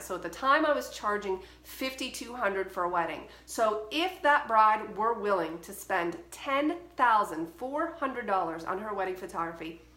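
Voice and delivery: normal talking voice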